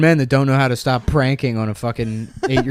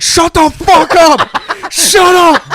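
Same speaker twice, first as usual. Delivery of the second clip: much higher, louder, laughing mock rage; furious yell; 7 dB louder, slower than usual. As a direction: scream it like a defensive kid. Kid defensively yelling.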